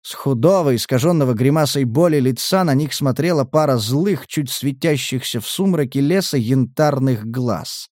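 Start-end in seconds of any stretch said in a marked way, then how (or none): none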